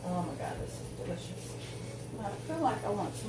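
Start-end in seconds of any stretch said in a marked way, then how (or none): none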